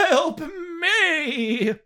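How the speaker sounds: high hammed-up plea; mock pleading cry. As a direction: beg it in mock tears. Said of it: "dramatic reading".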